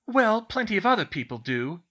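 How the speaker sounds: high, loud grumble; grousing. A narrator's reading. Hermione defends herself to Ron, grousing. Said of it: "she groused".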